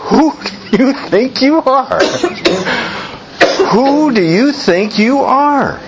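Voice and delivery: high, ringing demand; demanding delegation. Retold or imitated. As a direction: challenge him as the delegation. Delegation questions John.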